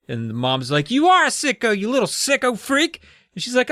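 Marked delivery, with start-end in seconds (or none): 0.9-3.0 high-pitched mock scolding; mock angry mom. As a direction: hurl it back as a worked-up mother. Acted quarrel, Mom insults Amy.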